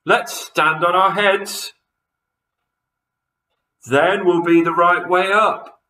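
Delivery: high, loud burst of inspiration; cried out. A narrator's reading. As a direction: announce a brilliant plan excitedly. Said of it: "cried Mister Twit".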